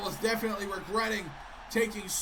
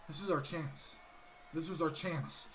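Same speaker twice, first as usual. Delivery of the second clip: much lower, softer, bitter; bitter lament; 7 semitones lower, 10 dB quieter than usual. Acted. Gable lamenting the lost chance, low and soft. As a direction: let the disappointment sink in low. Gable scolds his partners.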